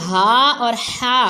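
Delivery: teacher contrasting the two ہا sounds; two h sounds contrasted. Shown as a demonstration. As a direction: breathe each ہا from a different part of the throat. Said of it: "ہا حلق کے آخری حصے سے".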